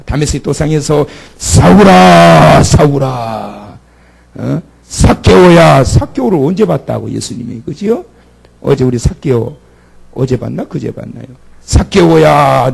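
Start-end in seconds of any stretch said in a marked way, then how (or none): 1.4-3.8 very slow, loud, drawn-out summons; divine call. Acted. Heavenly voice calls Saul on the Damascus road, slow and loud. 4.9-5.8 calling up. Acted. Jesus calls Zacchaeus. 11.6-12.7 loud, slow, stretched call upward; calling up the tree. Acted. Jesus calls Zacchaeus down from the tree, loud and slow.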